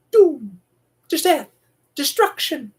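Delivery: hammed-up apocalyptic cry; dramatic doom. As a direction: proclaim it like a prophet of doom. Mimics the doom over the intercom.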